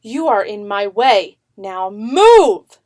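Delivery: loud, insistent order; loud command. A narrator's reading. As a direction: push the wall away with your voice. Yusha orders the wall again.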